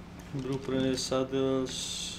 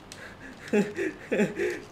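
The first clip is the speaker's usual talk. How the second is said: breathless giggling; high wheezy giggle; laugh; 7 semitones higher than usual. Real, not acted. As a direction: laugh helplessly. Amused after Giga kill.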